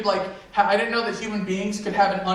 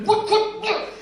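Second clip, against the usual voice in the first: high-pitched mock sputter of outrage; hammed-up fuming, in short high bursts; 12 semitones higher, slower than usual. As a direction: imitate someone too mad for words. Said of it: "He was fuming".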